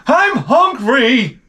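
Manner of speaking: high, slow whine; whiny wife voice. Retold or imitated. Wife whining to husband about food, high and slow. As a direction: drag it out like a pouty kid. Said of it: "She's like".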